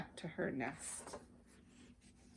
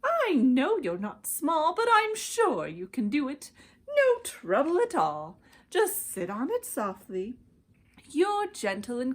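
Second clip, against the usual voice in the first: higher coaxing bird voice; lightly voiced persuading bird; Maisie the bird; 5 semitones higher, 3 dB louder than usual. A narrator's reading. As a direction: sweet-talk him like a small bird. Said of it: "answered Maisie".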